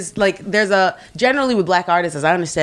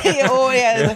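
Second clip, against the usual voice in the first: higher, laughing Minnesotan imitation; mock Minnesotan accent; 5 semitones higher, much slower than usual. Joking about Scandinavian roots, mock Minnesotan accent. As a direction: round the O's in a playful Minnesotan accent.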